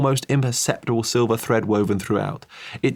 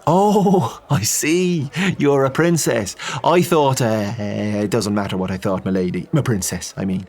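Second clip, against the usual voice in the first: flustered Irish priest; 3 semitones higher, 3 dB louder than usual. Acted. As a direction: backpedal nervously. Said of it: "The Irishman".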